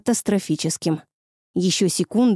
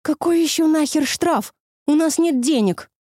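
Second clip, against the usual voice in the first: outraged; 7 semitones higher than usual. Acted. Lucy outraged at the fine.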